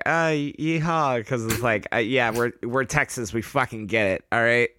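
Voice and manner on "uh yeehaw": slow, unenthused yeehaw; half-hearted yeehaw. Retold or imitated. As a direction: drag out a reluctant yeehaw. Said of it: "people were like".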